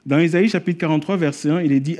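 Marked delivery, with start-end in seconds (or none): none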